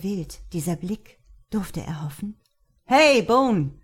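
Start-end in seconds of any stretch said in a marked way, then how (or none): 2.8-3.7 loud, high call. Acted. Luke calls over to Mark again, loud and high. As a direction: call him out again, louder. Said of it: "rief Luke erneut".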